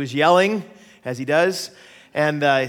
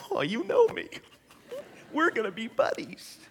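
much higher voice for the newborn's thoughts; imagined baby voice; 8 semitones higher, 5 dB quieter than usual. Retold or imitated. Father voicing his newborn son's happy thoughts, high baby voice. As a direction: give the baby a high little voice. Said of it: "And he's like".

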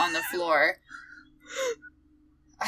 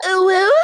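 loud laughter; 7 semitones higher, 6 dB louder than usual. Real, not acted. Laughing at own attempt.

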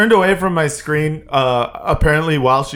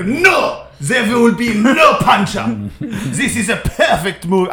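higher, haughty mock French director voice, half laughing; French accent; 3 semitones higher, slower than usual. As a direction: Luc Besson refusing the punch-up writers. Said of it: "I assume he has that French accent".

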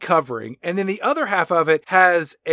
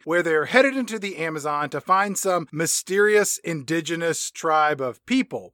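ordinary delivery

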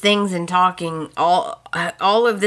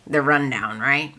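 ordinary talk

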